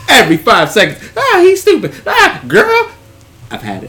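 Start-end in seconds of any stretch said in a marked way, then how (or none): 1.2-2.9 put-on much higher, louder girly voice; mock chatter. Friends talking through the movie, a high mocking voice.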